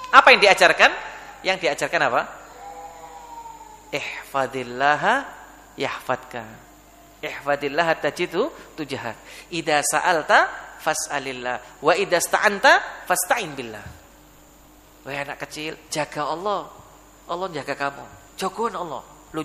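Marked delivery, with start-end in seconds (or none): 3.9-13.9 slow Arabic recitation. Shown as a demonstration.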